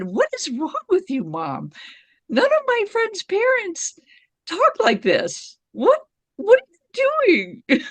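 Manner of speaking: high-pitched mock exasperation; incredulous protest, voice leaping up; exasperated son. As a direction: imitate a kid baffled by an embarrassing mom. Acts out her son's bewildered protest.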